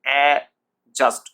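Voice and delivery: slow, loud a... just for অ্যাডজাস্ট; two separated beats in অ্যাডজাস্ট, no d; repeat-after-me a, just for অ্যাডজাস্ট; silent d. Shown as a demonstration. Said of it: "ডিটার উচ্চারণ আসছে না".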